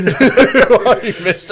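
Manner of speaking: laughing mock bartender. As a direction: stretch it out giggling like a puzzled old bartender. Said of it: "the fifty-year-old bartender's like".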